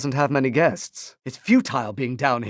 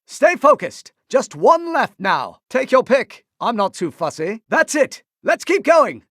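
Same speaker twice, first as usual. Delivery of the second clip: rallying call; 10 semitones higher than usual. Acted. Master urging his apprentice on in combat, raised and high. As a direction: spur the team on.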